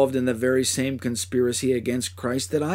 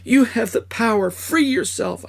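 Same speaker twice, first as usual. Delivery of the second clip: much higher, louder desperate plea; desperate begging; 10 semitones higher, 4 dB louder than usual. Acted. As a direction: beg him to save himself. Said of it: "I begged".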